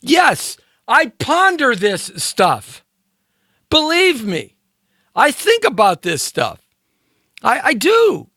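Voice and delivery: high, slow, drawn-out insisting; high-pitched insistence. Real, not acted. Host defending his musings to listeners, high-pitched insistence. Mock-earnest insistence after laughing.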